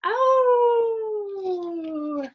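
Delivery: long high wolf howl, hammed up; howl like a werewolf. A narrator's reading. Boy howls at his sister's joke, long high howl. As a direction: throw the head back and howl high. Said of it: "I tipped my head back and howled".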